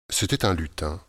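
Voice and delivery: plain reading voice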